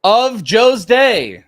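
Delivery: loud, high, drawn-out announcer call. Acted. Host finishes the betting segment title, big announcer call. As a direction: belt out the segment title slowly.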